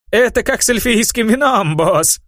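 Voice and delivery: put-on high, sly old satyr. A narrator's reading. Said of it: "добавил Флейгрей".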